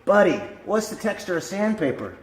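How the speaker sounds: high-pitched question to a dog; bright-pitched showman quizzing his pet; man prompting dog. Acted. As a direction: coax your dog through a trick. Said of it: "And the guy goes".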